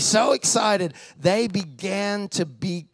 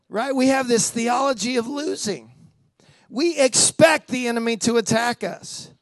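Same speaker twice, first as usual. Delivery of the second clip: ordinary talk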